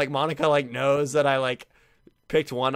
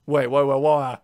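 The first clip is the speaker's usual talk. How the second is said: loud, hurried repeated waits; startled panic; 8 dB louder than usual. Real, not acted. Gamer jolted by the creepy poem, loud panic. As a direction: freeze up in sudden alarm.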